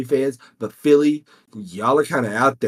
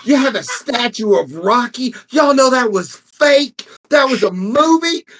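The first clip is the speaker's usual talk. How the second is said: higher, louder mock heckling of the crowd; trash-talk the Philly crowd in a raised voice; imitating Bill Burr; 9 semitones higher, 4 dB louder than usual. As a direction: comedian roasting a hostile Philly crowd, mock rant. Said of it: "where he's in the crowd talking about Philly".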